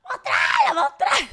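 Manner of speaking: mock angry shout; yelling. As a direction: bellow it out. Acted yelling quote.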